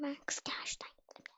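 breathy sudden whisper. Real, not acted. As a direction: drop to a secret whisper. Fısıltıyla oyun yorumu. (fısıltıyla)

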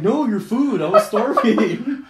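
Leslie's protest, dissolving into laughter; mimicking the friend while cracking up; laughing imitation. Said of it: "she was like".

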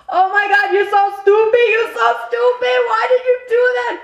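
very high, loud mock shriek; frantic scolding. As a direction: shriek it in retold outrage. Russian passenger's retold panicked scolding.

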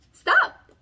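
sudden loud high cry; sudden high shout. A narrator's reading. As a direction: burst out high and loud on the word. Water stops, dramatic.

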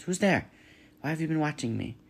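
high, wary possum hiss. A narrator's reading. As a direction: hiss it from the shadows. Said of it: "voice hissed up from the shadows".

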